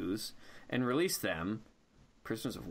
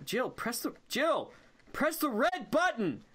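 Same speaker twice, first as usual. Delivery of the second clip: frustrated yelling; 10 semitones higher than usual. Real, not acted. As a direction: shout the order in frustration. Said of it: "I'm clipping the mic. I'm so angry".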